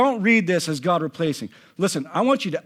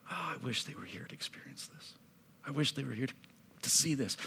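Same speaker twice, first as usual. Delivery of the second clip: low, soft voice sinking toward a whisper; hushed preaching; 4 semitones lower, 6 dB quieter than usual. Acted. Preacher voicing Naomi's wish for her lost family, hushed. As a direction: let it drop to an aching hush. Hushed, grieving part of sermon.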